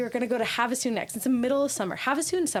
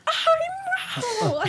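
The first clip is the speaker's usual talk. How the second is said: mock yell. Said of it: "I'm yelling".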